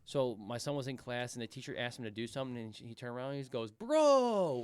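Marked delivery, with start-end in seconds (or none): 3.7-4.6 imitating a kid shouting bro; loud bro. Seven-year-old yelling bro at his teacher in class. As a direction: yell it right at the teacher. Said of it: "like, really loud to the teacher".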